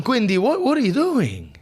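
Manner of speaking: high, strained pleading whine; mock crying plea. Client under the masseuse's elbow, whiny high plea. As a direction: squeal it out like it really hurts. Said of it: "Crying, going".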